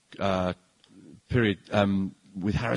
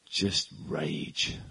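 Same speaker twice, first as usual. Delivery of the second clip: Harrison Ford line; 5 semitones lower, 6 dB quieter than usual. Retold or imitated. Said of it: "Harrison Ford says".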